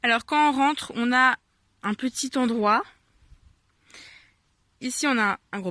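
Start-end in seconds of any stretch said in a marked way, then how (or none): none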